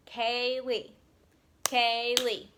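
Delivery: "Kaylee" drawn out in two beats, repeated; slow, even syllables on "Kaylee"; split into syllables. Shown as a demonstration. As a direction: clap out kay, lee for "Kaylee". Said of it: "Let's see how many Kaylee has".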